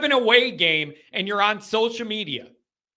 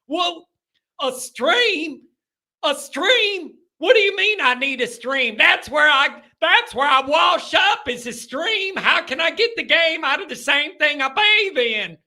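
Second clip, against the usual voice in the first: put-on high hillbilly accent rising to a shout; 8 semitones higher than usual. Host mocking a trailer-park fan baffled by streaming, in a hillbilly accent. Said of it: "I could see 'em now".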